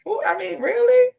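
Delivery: rising disbelief that ends in a squeal; incredulous. Real, not acted. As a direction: pitch shoots way up on really. Disbelief at a comment.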